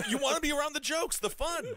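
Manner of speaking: excited. Real, not acted. Teasing about the fun jokes editor.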